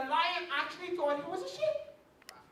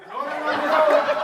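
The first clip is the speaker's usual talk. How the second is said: ordinary talk, nothing marked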